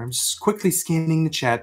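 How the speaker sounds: ordinary talk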